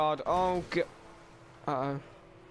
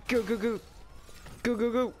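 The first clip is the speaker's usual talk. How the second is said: urgent go go go; 7 semitones higher than usual. Real, not acted. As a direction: rush it, frantic. Escaping police.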